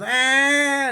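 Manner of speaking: loud high mock kid's wail; child's tantrum scream. Eight-year-old refused candy, loud high mock tantrum yell. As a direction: scream like a kid denied candy. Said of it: "And he goes".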